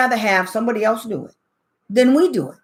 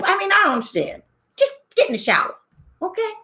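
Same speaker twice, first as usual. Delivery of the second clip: ordinary talk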